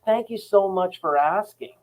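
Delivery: exaggerated customer-service politeness; mock politeness. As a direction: draw out the scripted thanks, sending it up. Mimics callers' scripted pleasantry.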